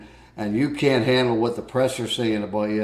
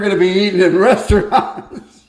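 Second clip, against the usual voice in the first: higher, louder no no no; Mike protesting; 8 semitones higher, 7 dB louder than usual. Retold or imitated. Mike answering the wife's joke about fast food, a louder protest. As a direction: wave off the idea, laughing loud. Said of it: "Mike goes".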